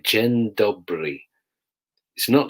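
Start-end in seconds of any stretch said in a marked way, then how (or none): 0.0-1.2 "Dzień dobry" said slowly as jen dobry; Polish greeting slowly. Shown as a demonstration. Custody sergeant teaching his Polish greeting, slow careful. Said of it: "J E N space D O B R Y".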